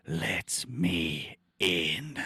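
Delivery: very slow, eerie drawn-out plea; creepy voice. Acted. Streamer voices the thing scratching at the car, slow and eerie. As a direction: stretch it out like something at the door.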